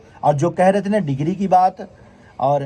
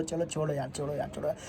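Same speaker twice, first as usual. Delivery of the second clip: softer and softer, fading to almost nothing; resigned letting go; 4 semitones lower, 14 dB quieter, faster than usual. Retold or imitated. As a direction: mutter it to yourself as if brushing it off. Retelling letting insults slide.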